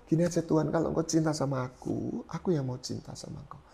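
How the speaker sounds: soft, choked-up retelling of the prayer; moved near tears. Real, not acted. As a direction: voice fading as emotion rises. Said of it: "Nggak apa-apa, itu oke". (suara lirih menahan tangis)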